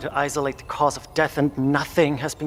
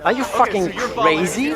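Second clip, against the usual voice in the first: outraged; 6 semitones higher than usual. Acted. Colleague hears sacrifice plan.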